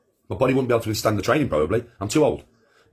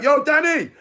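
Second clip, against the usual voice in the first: high, louder call across the beach; mock shout; 5 dB louder, slower than usual. Passer-by on the beach shouting to him, a high call. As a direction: yell his name like a passer-by. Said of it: "Just shout".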